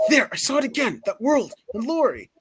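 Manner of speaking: high, theatrical mock amazement; startled villain. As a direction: voice a villain who saw a vision. Acted villain line in story.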